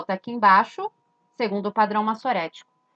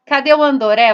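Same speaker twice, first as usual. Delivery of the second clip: calling out; 4 semitones higher, 8 dB louder, faster than usual. Pretend. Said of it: "será que a gente vai conseguir".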